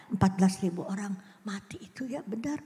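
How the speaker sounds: hushed whisper about the dead. Acted. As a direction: whisper it like a grim secret. Stresses the shocking death toll.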